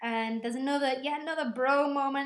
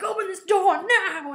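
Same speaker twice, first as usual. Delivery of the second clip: loud, higher command; shouted demand; 9 semitones higher, 12 dB louder than usual. Retold or imitated. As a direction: shout the order through the glass. Spock demanding the door open.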